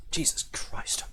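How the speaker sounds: soft, whispered oath; exasperated whisper. Real, not acted. Streamer facing insatiable viewers, whispered exasperation. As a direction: breathe it out in disbelief. Worn down by viewers' repeated requests.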